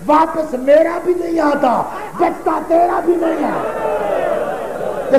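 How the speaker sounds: drawn-out wail, slow and high on آتا; wailing lament. Acted. Husain answering Sakina, wailing and drawn out. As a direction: stretch "بچتا تیرا بھی نہیں آتا" into a slow, high wailing cry. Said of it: "سر چوم کے حسین کہتا ہے".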